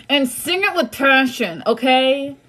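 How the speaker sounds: high, loud and fired up; passionate urging. Real, not acted. Reader urging viewers to sing it, high and loud. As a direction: hype them up to belt it.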